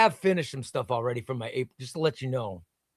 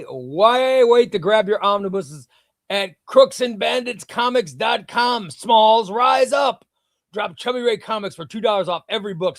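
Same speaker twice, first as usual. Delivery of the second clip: pumped-up sponsor plug, higher and louder; hyped ad read; 5 semitones higher, 3 dB louder than usual. Acted. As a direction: sell the comic shop like a hype announcer. Sponsor ad read mid-stream.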